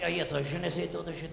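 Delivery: mock French accent. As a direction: fake French phrase, lightly mocking. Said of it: "You don't know what to expect".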